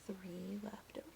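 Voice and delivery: very soft whispered answer; whispered count result. Acted. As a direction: breathe the number out.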